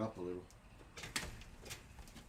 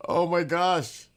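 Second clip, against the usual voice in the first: slow, higher and loud oh my gosh; shocked exclaim; 8 semitones higher, 11 dB louder, much slower than usual. Real, not acted. Streamer recoiling from the story, slow and loud. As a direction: let the shock ring out.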